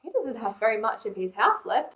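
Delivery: usual speaking voice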